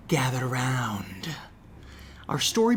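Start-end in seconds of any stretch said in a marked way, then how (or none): 0.1-1.3 slow hushed whisper to draw listeners in; campfire whisper. Acted. Host beckoning listeners to a spooky story, whispering. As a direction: whisper it like gathering kids round a fire.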